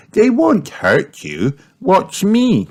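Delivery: put-on high little-girl voice; Peppa. A narrator's reading. Child showing friend it is safe, high encouraging voice. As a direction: reassure confidently, pitched well up. Peppa reassures Richard, shows him.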